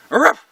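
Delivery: sharp, high, loud bark; short explosive imitated bark; dog bark. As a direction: bark out once like a guard dog. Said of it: "and he barks".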